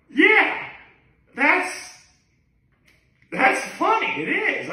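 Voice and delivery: high, giggly; amused laughing. Real, not acted. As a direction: laugh through the words. Cracking up at the joke.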